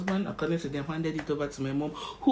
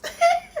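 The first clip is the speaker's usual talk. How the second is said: high laugh; 8 dB louder than usual. Real, not acted. Laughs at own retort.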